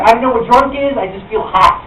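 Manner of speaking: normal talking voice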